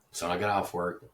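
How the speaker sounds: ordinary talk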